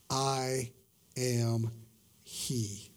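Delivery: "I am he" low and soft, a pause after each word; solemn declaration. Acted. Jesus answering those come to arrest him, solemn. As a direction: say "I am he" word by word, hushed and grave.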